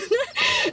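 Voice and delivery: short squeaky giggle; high burst of laughter. Real, not acted. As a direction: laugh at own story. Laughing at the memory.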